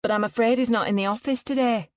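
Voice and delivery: a hint of Manchester in the sympathy; Mancunian accent. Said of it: "with a strong Mancunian accent".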